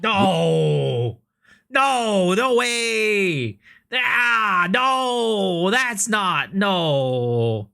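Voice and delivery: loud, rising disbelief, half shouting; excited no. Real, not acted. Streamer shouting at the trailer in loud excited disbelief.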